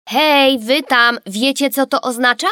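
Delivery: high, loud call to the parents; calling for attention. A narrator's reading. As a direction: make them finally hear you. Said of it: "powiedziała raz jeszcze". (głośne wołanie do rodziców)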